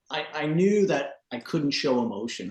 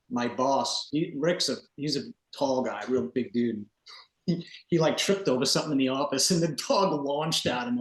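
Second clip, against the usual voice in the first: ordinary delivery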